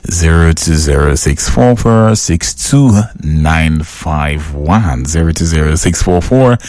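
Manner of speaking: number read out slowly, digit by digit; a slow, careful read of the digits; slow dictation. Shown as a demonstration. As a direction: spell out the phone number slowly so they can write it. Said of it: "make you ring, ring me".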